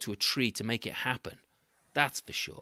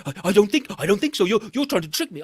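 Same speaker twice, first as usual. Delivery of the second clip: suspicious monkey; 5 semitones higher, 9 dB louder, much faster than usual. A narrator's reading.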